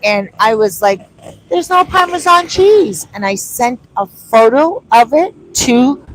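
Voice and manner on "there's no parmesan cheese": high, loud mock outrage; indignant quote. Vlogger retelling her complaint, high mock outrage. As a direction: sound baffled that the cheese is missing.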